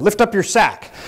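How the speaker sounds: high, loud drill-style order; barked command. Retold or imitated. Lance corporal orders prisoner during strip search, loud shout.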